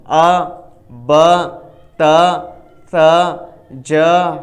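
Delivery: each syllable from "آ" to "ی" sounded alone with an open a, spaced by pauses; rawan syllable drill. Shown as a demonstration. Teacher showing rawan reading of zabar, isolated open-a syllables like "با", "تا". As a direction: give only the sound, "با", "تا", "ثا", one isolated syllable at a time. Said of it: "اس کو کہیں گے رواں".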